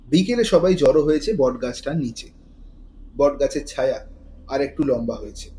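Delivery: ordinary delivery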